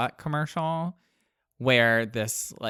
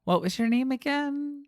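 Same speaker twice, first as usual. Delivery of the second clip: pitched up, playful; mock high voice; 11 semitones higher than usual. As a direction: squeak it up innocently. Mocking a morning-after question.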